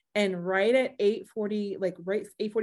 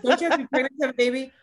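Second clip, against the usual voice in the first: high, louder, hammed-up confusion with laughter; baffled brother; 5 semitones higher, 6 dB louder than usual. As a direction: mimic a bewildered brother, half laughing. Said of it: "my brother was so funny".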